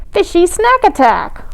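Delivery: high-pitched excited cry; gleeful battle cry. A narrator's reading. Polly starts throwing food, excited cry. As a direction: announce the attack with glee.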